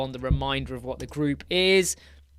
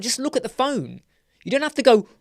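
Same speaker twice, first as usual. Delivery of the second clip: high, impatient, louder at the end; impatient retort; 7 semitones higher, 3 dB louder, faster than usual. Retold or imitated. Retelling his impatience with an older woman at face detection, loud at the end. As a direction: snap at her to just look at the phone. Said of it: "I'm like".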